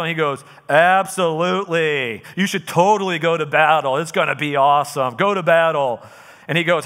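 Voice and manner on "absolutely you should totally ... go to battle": smart-aleck. Acted. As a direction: play the sarcastic prophet humoring a king. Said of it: "because he's a smart aleck".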